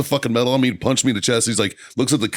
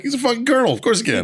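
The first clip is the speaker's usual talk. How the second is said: incredulous retort. Retold or imitated. Answering marines, incredulous.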